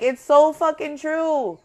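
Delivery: usual speaking voice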